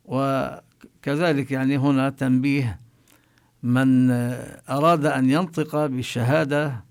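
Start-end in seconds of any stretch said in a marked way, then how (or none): none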